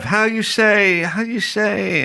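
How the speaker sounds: high, slowed "how you say" with a hint of Italian accent; hammed-up Italian searching for words; mock Italian accent. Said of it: "thick Italian accent".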